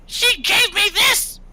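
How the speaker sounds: slow, very high squeaky shout; outraged little creature. Acted. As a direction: shriek each word in a tiny creature voice. Gitmo protests to the player.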